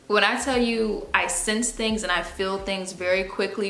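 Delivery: usual speaking voice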